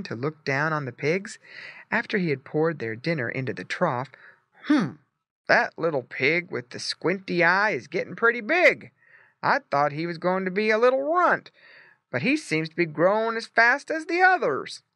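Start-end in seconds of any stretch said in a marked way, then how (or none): 4.6-14.8 a hint of a gruff farmer, a bit higher; lightly voiced farmer. A narrator's reading. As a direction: farmer musing half surprised.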